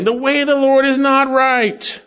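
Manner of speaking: high, loud mocking quote of Israel's complaint; quoting the complainers. Preacher mimics Israel grumbling against God, high and loud. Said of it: "They're saying".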